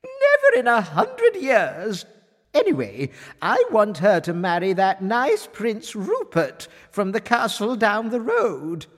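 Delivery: high, loud, imperious refusal; disgusted queen. A narrator's reading. Queen rejects crab in favour of Prince Rupert, disgusted. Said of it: "cried the queen in disgust".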